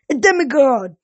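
sudden hostile exclamation; snarl. A narrator's reading. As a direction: snarl it out in alarm. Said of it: "one snarled".

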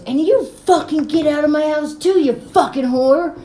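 hammed-up angry shout at the girls; retold furious yell. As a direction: spit it out like the enraged boyfriend. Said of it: "and he goes".

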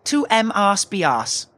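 "M arse be arse" as slow separate syllables; mispronunciation. Acted. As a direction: misread Mars bars slowly as "M arse be arse". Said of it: "You mean Mars bars".

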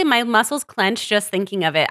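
normal talking voice

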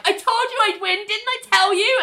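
very high smug boasting; gloating. Retold or imitated. Ling gloating to the team, high teasing boast. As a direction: crow it like you knew all along. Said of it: "Ling's like".

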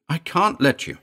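high, insistent refusal; anxious woman's protest; sharp protest. A narrator's reading. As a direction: refuse him, voice pitched well up. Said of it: "she cried sharply".

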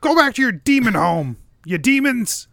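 high-pitched scolding hermit character; zrzędliwy Vrath. Acted. Vrath chasing the demons off.

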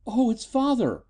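high-pitched distressed daughter; wailing. A narrator's reading. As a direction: wail it high in dismay. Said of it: "she wailed".

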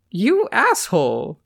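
playful mock offence; smiling insult. A narrator's reading. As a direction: stretch it out with a smile. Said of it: "she said with a smile".

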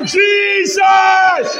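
high, loud, drawn-out cry; long ringing preacher's shout. Shown as a demonstration. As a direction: shout the name for the congregation to follow. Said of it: "I didn't hear you shout".

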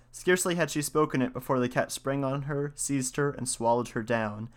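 usual speaking voice

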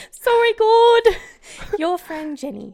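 high, loud mock British confession; British accent. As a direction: squeal it in a hammed-up British accent. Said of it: "should I do it in a British accent?".

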